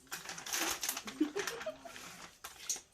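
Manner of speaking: soft high giggle; quiet giggle at your own joke. Real, not acted.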